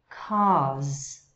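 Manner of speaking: "cars" with no R, vowel held; British "cars"; British dropped R. Shown as a demonstration. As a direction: leave the R out of "cars". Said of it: "Notice the difference".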